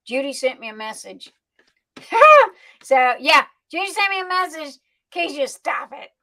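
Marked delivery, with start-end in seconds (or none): 2.0-2.5 loud, high single laugh; sudden high hoot of laughter; burst of laughter. Real, not acted. Reacting to Judy's message, a loud high laugh.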